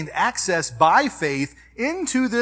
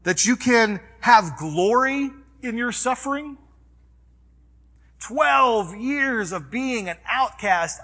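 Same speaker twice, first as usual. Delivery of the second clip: raised, emphatic preacher voice; preaching; 8 semitones higher, 4 dB louder than usual. Acted. Pastor preaching to the congregation, raised and emphatic. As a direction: preach it out loud to the church.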